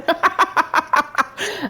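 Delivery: high, rapid cackling laugh. Real, not acted. Player amused by confused Oswald, laughing in a high giggle. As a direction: burst into a string of high giggles.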